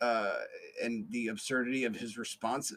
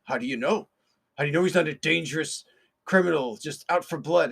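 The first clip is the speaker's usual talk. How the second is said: higher, louder challenging questions; hammed-up doubting bystander; mock skeptic; 3 semitones higher, 7 dB louder than usual. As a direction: needle Pat like a know-it-all critic. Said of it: "somebody might say".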